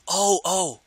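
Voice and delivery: high startled gasp of oh; surprised. Real, not acted. Caster reacting to a sudden play, surprised.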